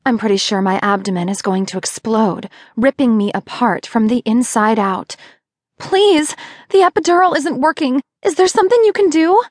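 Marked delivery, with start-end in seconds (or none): 5.8-9.4 high, fast, pleading in pain; high rushed plea from a labouring mother; desperate plea. Acted. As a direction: beg urgently through the pain. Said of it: "I hiss".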